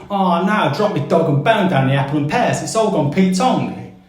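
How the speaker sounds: a Cockney mate moaning about his dog and bone; Cockney accent. Shown as a demonstration. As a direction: drop into a broad East End Cockney. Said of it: "So if a Cockney says to you".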